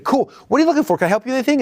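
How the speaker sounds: high, bright helpful clerk voice; eager salesperson. Retold or imitated. Salesperson asking the customer what he needs, high and eager. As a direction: offer help like a keen shop assistant. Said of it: "He's like".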